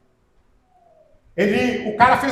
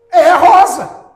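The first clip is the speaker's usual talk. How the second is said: high, loud assertion; emphatic insistence; 7 semitones higher, 11 dB louder, slower than usual. Retold or imitated. Audience member answers the doubting question, emphatic insistence. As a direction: punch "rosa" high and loud.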